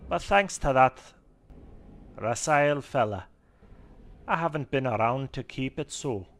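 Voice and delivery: high, loud Scottish owl keeper; chatty Scottish gnome woman; Scottish woman accent. Acted. Owl keeper explaining the messy owlery, Scottish accent. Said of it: "I don't know how to do a Scottish woman".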